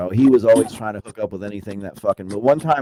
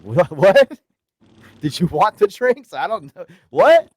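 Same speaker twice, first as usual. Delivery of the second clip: much higher, louder, baffled; mock innocent surprise; 10 semitones higher, 9 dB louder than usual. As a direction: play dumb, all shocked innocence. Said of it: "I was like".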